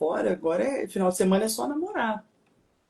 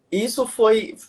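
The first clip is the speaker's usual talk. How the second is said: usual speaking voice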